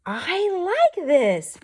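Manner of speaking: high, loud and slow with delight; happy quack. Acted. Teacher reading Pete's happy line, high and delighted. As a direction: stretch it out gleefully like a happy duckling. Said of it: "Pete quacks".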